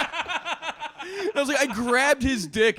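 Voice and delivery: high laughing, slow; laughing hard. Real, not acted. Laughing while telling friends the story. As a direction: crack up mid-story.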